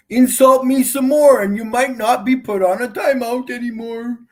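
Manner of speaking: taunting warning. Real, not acted. Streamer warning commenters who insult him, loud taunt. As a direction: pitch it up and push it loud.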